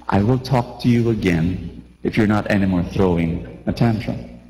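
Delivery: put-on low, even and calm to the child; steady calm talk to a raging kid; very calmly. Shown as a demonstration. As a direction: parent calmly setting a limit. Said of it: "and then very calmly, very calmly says".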